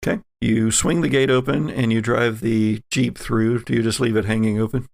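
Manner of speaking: ordinary talk, nothing marked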